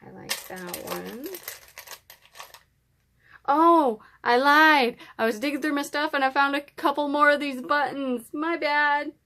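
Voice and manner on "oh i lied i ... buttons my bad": excited. Real, not acted. Excited return with more buttons.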